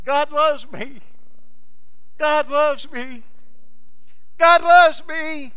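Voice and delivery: high, loud, drawn-out joyful yell; child yelling. Retold or imitated. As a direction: shout it out like a delighted little girl. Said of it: "they can hear her yelling".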